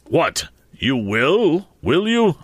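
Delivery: sudden angry bluster. A narrator's reading. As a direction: bluster it at him. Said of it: "my guardian blustered out".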